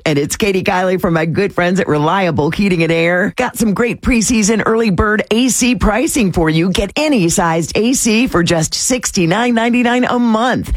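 lively commercial pitch; radio ad read. Acted. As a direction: sell it like a friendly local radio host. Reads a sponsor ad.

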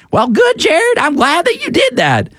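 high squeaky cartoonish voice; squeaky Clark Howard voice. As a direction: pitch way up into a squeak. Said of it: "I gave Clark such a squeaky voice".

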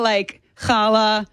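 challah with a throaty ch, drawn out slowly; challah, guttural ch, slow; Hebrew ch sound. Shown as a demonstration. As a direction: drag out challah, rasping the ch. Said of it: "It's the cha".

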